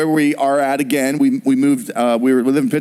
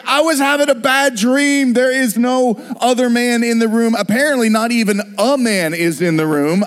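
exasperated wife; 6 semitones higher than usual. Retold or imitated. Wife scolds the panicking husband.

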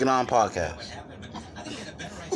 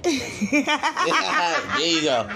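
high laughing there you go; laughing approval; 9 semitones higher, much slower than usual. Real, not acted. Host laughing as the co-host gets it. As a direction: laugh through the praise. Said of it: "laughs while approving".

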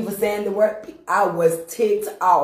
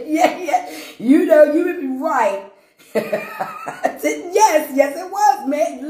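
higher, louder, words through laughter; laughing; 8 semitones higher, 4 dB louder, slower than usual. Real, not acted. Agreeing with the listeners through laughter.

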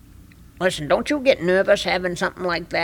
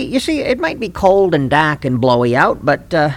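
usual speaking voice

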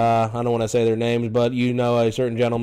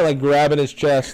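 ordinary delivery

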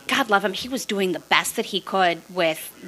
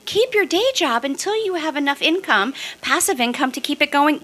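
mock advice; 8 semitones higher than usual. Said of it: "everybody tells you".

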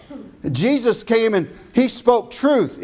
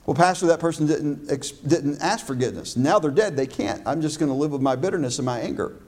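lower, softer voice of a sulking churchgoer; bitter excuse; 6 semitones lower, 5 dB quieter, faster than usual. Acted. Pastor voicing a congregant who won't forgive, low grumbling. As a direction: mimic a bitter member grumbling back at the pastor.

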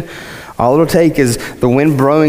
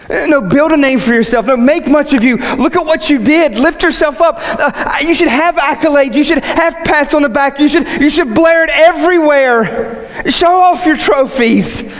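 high, insistent voice of the world; mock worldly urging; 12 semitones higher than usual. Preacher voicing the world's logic to the congregation, mock urging. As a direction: egg them on with hammed-up fervour.

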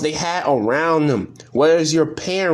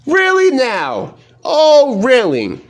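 higher, sarcastic "really now", then a louder "oh"; mock disbelief, dripping with sarcasm; incredulous; 6 semitones higher, 4 dB louder, slower than usual. Real, not acted. Reacting to the player admitting racism, sarcastic disbelief.

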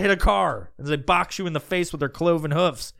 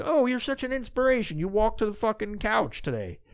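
higher, gushing mock praise; mock fawning; 6 semitones higher, 3 dB quieter than usual. Podcaster mocking fans praising a faker, mock gushing. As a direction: gush like a fawning fan.

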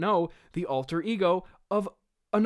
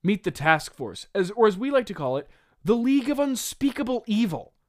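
loud, higher, theatrical announcement; hammed-up big reveal; grand villain introduction; 6 semitones higher, 5 dB louder than usual. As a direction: proclaim it like a pompous villain. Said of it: "he says".

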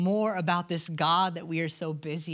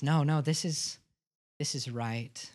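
tender reassurance; 2 semitones lower than usual. Retold or imitated. Jesus answers Mary softly.

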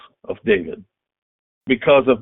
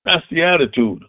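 usual speaking voice

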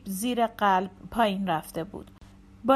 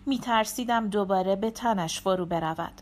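plain reading voice

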